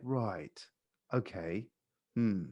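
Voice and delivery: low, soft, unhurried musing; changed, calm tone. Shown as a demonstration. Sales coach showing a pattern interrupt, calm low tone. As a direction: drop low and quiet, pausing between words. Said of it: "change my tone, and it's like".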